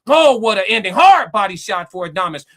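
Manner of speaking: loud, excited call of the finish; excited shout. Real, not acted. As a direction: yell it as the bell nears. Fight's final seconds.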